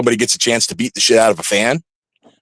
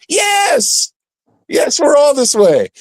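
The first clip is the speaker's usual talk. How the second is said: defiant yes; 13 semitones higher, much slower than usual. Real, not acted. Wrestler answering fans who think wrestlers are crazy, defiant shout. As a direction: throw it out proudly, almost shouting.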